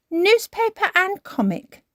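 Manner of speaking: put-on high child-character voice; Peppa. A narrator's reading. Peppa delivers the dogs' order, high child voice. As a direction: announce the items in a high voice.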